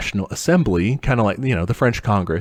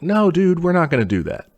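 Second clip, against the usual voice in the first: casual king's refusal; 8 semitones higher than usual. Retold or imitated. King waves off the French envoy's demand with a breezy refusal. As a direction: toss off a breezy refusal in a higher voice. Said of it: "the king is just like".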